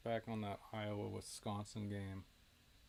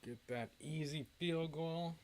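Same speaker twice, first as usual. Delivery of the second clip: higher, slow, teasing drawl on field goal; drawn-out mocking; 5 semitones higher than usual. Shown as a demonstration. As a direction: stretch it out like a taunt. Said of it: "repeats it drawn out".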